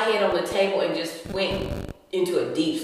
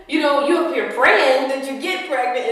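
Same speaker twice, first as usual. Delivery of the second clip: ordinary talk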